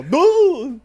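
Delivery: big high, loud boom; imitated explosion. Friend imagining a missile into the blade, booming shout.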